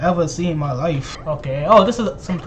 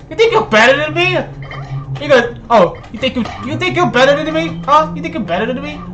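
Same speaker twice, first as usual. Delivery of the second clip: mock taunt; 7 semitones higher than usual. Cocky taunt at a rival player. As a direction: challenge them like a cocky rival.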